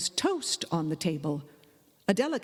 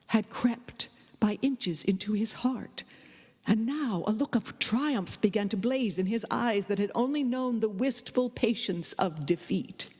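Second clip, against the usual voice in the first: ordinary delivery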